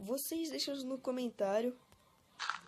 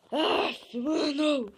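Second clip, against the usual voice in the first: loud exasperated cry; frustrated outburst; 3 semitones higher, 9 dB louder, much slower than usual. Real, not acted. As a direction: vent the frustration loudly. Gamer frustrated after dying.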